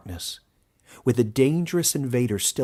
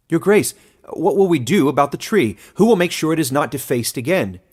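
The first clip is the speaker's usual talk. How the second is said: put-on higher and louder anxious young man; young man calling out; 6 semitones higher, 8 dB louder than usual. A narrator's reading. Young man in the crowd calling out anxiously to the elder. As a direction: shout the question from the crowd.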